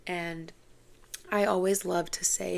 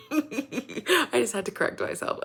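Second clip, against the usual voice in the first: laughing; 5 semitones higher, 6 dB louder than usual. Real, not acted. Laughing after correcting herself.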